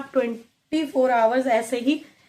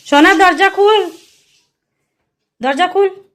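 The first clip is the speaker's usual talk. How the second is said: much higher, louder stern call; calling through the door; 7 semitones higher, 7 dB louder than usual. Real, not acted. Mother calling toddler to open the shut door, loud stern call. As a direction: demand she open the door now.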